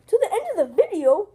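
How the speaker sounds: high, loud incredulous question; shocked disbelief. Acted. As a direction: echo it back in shock. Shocked repeat of the wait.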